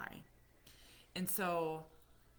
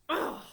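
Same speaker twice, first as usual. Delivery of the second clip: imitated annoyed groan; 9 dB louder than usual.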